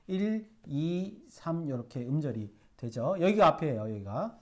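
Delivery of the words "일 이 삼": slow counting. Shown as a demonstration. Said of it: "삼음절이 됩니다".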